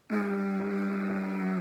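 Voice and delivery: long, steady buzz through closed lips; sustained lip buzz. Shown as a demonstration. Teacher demonstrating a four-second mouth sound, steady lip buzz. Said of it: "do it for four seconds".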